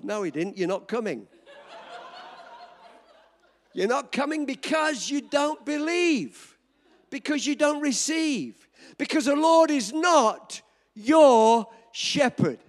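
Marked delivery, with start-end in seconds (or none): 3.7-12.6 slow, weighty pulpit warning; slow preaching that swells loud at the end; slow emphatic preaching. Acted. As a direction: hammer the warning home slowly.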